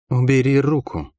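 сдержанно процедить сквозь улыбку; процеди это с лёгкой угрозой, улыбаясь; цедит с улыбкой. A narrator's reading. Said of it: "процедила". (негромкий приказ, процеженный сквозь улыбку)

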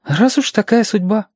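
put-on high child voice, resigned; resigned, but pitched high as a child; sighing child. A narrator's reading. Reshka accepting his fate before Alexander, sighing child voice. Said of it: "вздохнул он".

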